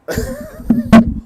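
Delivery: loud, high burst of laughter; helpless high-pitched laughing; real high laugh. Real, not acted. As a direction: crack up in disbelief. Cracks up at the clip.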